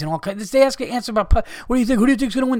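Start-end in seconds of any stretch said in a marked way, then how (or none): 1.7-2.6 higher, mocking reporter voice. Mocking media day reporters, he apes a silly question.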